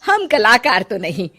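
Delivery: put-on loud, admiring older woman; delighted mother-in-law. Mother-in-law praises her bahu's paintings to her son, loud and delighted. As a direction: gush over the paintings.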